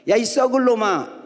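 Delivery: high, cussing country-mother voice; mother's scolding. Retold or imitated. Mother cursing her son who fears ghosts, scolding. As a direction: imitate a mother telling off her boy, pitched high.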